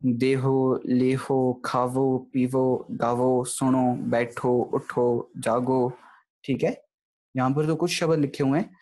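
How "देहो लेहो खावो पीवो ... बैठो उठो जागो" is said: देहो लेहो said with a clear final o; slow list, each word ending on o; verbs with final o. Shown as a demonstration. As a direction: recite each verb, voicing the o at the end. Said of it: "जो हमारे पास क्रिया शब्द आ जाते हैं".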